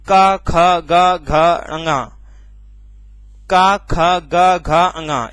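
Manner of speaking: "का ख ग घ अंगा" spoken slowly, each syllable separate; teacher drilling the velar row slowly. Shown as a demonstration. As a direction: sound out "का ख ग घ अंगा" one by one from the throat. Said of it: "यहाँ पर आप लोग जब, अ, बोलोगे".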